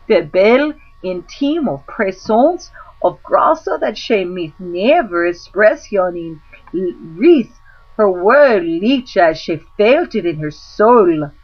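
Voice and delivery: plain reading voice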